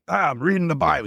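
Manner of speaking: high-pitched, raspy Italian grandpa; Pepe's raspy Italian voice. Retold or imitated. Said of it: "He's got this Italian raspy voice".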